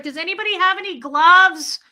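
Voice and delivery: loud, high plea to strangers; mock distress, calling across a restroom; desperate call-out. Calls out for gloves at airport.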